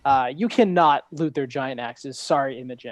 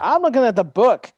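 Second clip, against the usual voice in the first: exasperated shout; 7 semitones higher, 8 dB louder than usual. Real, not acted. GM arguing over stat block.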